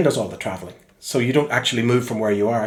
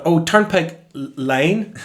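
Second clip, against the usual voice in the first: slowed repeat; 5 semitones higher, much slower than usual. Retold or imitated. Spelling it out for driver.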